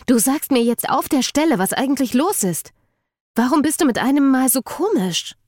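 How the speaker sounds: deutlich höher, eindringlich fordernd. Acted. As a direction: verlangt höher und drängend Klarheit. Eden fordert verängstigt eine Antwort. (Eden fordert von Jackson eine Antwort, höher und drängend)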